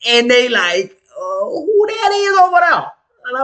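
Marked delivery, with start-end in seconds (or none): 1.1-2.7 high, slow curious voice; a pointed-up "who that is over there" from across the club; imitating a clubgoer. Clubgoers asking about him.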